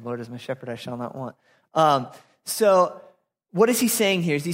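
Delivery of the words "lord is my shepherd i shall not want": quiet re-read. Real, not acted. Re-reading the verse off the screen.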